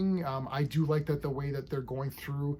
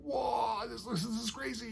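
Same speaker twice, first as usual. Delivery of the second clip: high, hushed mock awe; mock amazed; 9 semitones higher, 5 dB quieter than usual. Viewer imagining a big battle on screen, mock amazed. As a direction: pretend to be wowed, voice pitched right up.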